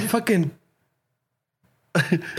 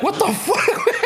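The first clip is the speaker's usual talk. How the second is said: laughing; 9 semitones higher than usual. Real, not acted. Laughing at friend's sleep talk.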